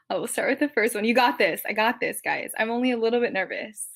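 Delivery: ordinary talk, nothing marked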